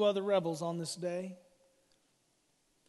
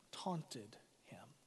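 whispered ending; 9 semitones lower, 17 dB quieter than usual. Acted. Preacher finishing the crucifixion reading, whispered. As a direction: whisper the last words much softer.

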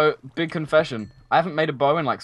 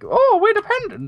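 much higher mock Welsh voice; 4 dB louder than usual. Explaining the Welsh to his friend, a high mocking Welsh voice. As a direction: squeak it out as a smug Welshman. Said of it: "It's like".

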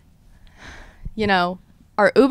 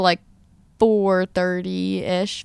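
ordinary talk

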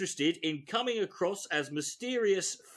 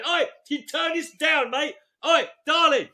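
loud, high laddish holler; raised-voice mock chat-up call; calling out in a nightclub; 8 semitones higher, 6 dB louder than usual. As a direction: shout over the club music.

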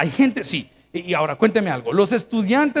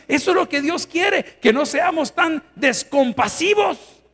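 slow, high shout; preaching shout; 7 semitones higher, slower than usual. Real, not acted. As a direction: hammer each word home. Preacher exhorting congregation.

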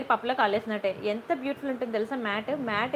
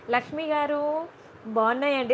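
ordinary talk, nothing marked

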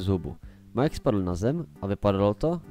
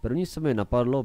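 ordinary delivery

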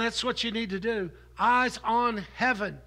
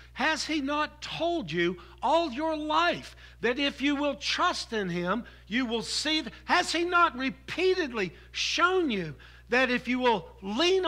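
impassioned preacher climbing in pitch; preaching at full force; 6 semitones higher than usual. Acted. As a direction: press the point home with rising fervor.

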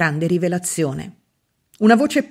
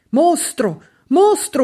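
high scream, much higher on the second; yelling; 8 semitones higher, slower than usual. A narrator's reading. Septimus screaming at the doctor coming in. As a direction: scream at the monster in the doorway. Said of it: "urlò Septimus".

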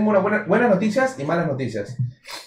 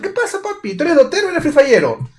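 mocking high voice; 9 semitones higher than usual.

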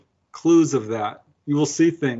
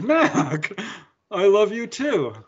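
higher, slow and tender; tender reply; 8 semitones higher, much slower than usual. Retold or imitated. Reunited with a dead friend, tender and slow. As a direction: answer the lost friend with love, slowly.

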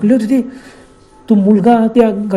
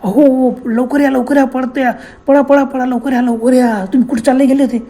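frantic calling; 5 semitones higher than usual. Retold or imitated. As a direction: call him back in a panic, half-hearted scream. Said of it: "जोराने मोठ्याने किंचाळ बोलली".

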